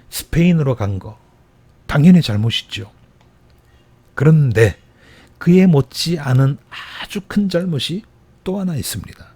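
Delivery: usual speaking voice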